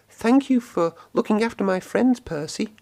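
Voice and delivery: high, thankful engine voice; Thomas the engine. A narrator's reading. Thomas thanking Percy for looking after friends, high engine voice. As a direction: puff it out like a cheery little engine. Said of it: "puffed Thomas".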